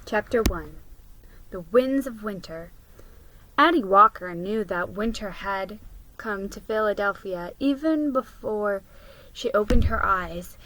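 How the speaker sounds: ordinary talk, nothing marked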